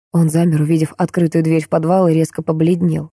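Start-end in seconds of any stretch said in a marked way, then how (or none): none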